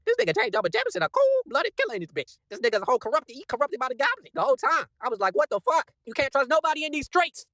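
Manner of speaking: high-pitched, incredulous outcry; stunned disbelief. Retold or imitated. Fan reliving a shock twist in the comic, stunned high outburst. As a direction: cry it out high, reeling at the betrayal. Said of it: "And I was stunned as hell".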